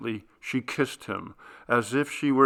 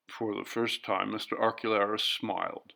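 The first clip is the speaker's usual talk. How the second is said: usual speaking voice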